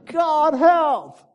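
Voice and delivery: very high, loud shouted prayer; desperate cry. Retold or imitated. Preacher acting the heart attack victim, crying to God. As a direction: shout to heaven for rescue. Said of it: "I cried to the Lord".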